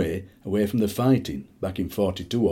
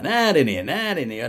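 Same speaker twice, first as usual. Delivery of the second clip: broad Sheffield voice, lightly done; a hint of broad Sheffield; Sheffield deedar accent; 5 semitones higher than usual. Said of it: "proper deedar accent".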